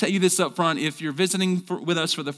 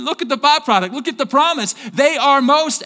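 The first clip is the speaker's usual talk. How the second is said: louder and higher, building to the word blessed; rising preaching call; 8 semitones higher, 7 dB louder than usual. Acted. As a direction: lift the crowd toward the answer.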